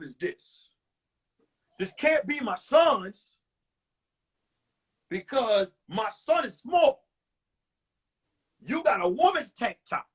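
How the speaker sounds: slow, deliberate demand; lightly acted suspicious boyfriend; mock interrogation. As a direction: question them slowly, pausing between phrases. Acting out suspicious man confronting her.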